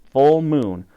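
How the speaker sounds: usual speaking voice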